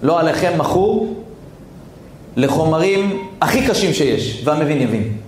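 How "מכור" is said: ordinary talk, nothing marked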